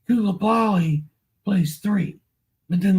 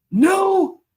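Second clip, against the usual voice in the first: dismayed cry; 9 semitones higher, 4 dB louder than usual. Real, not acted. Reacting to the injury news.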